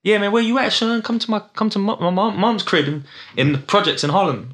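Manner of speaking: high, hyped New York hustler voice; playing an eager Harlem rapper inviting you over; New York accent. Imitating a New York rapper inviting him to Harlem, hyped. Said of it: "Whereas Americans be like".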